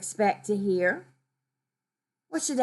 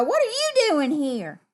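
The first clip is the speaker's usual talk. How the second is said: mildly rude question, pitched higher; rude demand; 7 semitones higher, 3 dB louder than usual. Acted. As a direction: voice a mock-rude challenge, higher. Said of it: "Because that would be rude".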